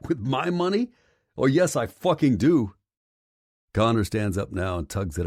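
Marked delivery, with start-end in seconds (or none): 0.1-2.7 high, disbelieving question then a loud comeback; incredulous retort. A narrator's reading. Hawk refusing his ex, high and incredulous. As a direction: throw it back at her in disbelief. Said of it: "laugh in disbelief".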